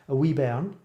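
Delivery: "a wee bairn" con acento escocés, la erre vibrante en "bairn"; Scottish accent. Shown as a demonstration. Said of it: "plus the accent".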